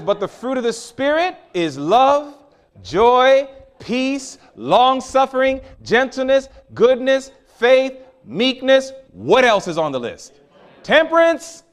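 loud, high, ringing list of virtues; full-force preaching. Acted. Preacher proclaiming scripture to the congregation, loud and ringing. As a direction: call out each fruit of the Spirit like a proclamation.

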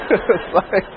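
usual speaking voice

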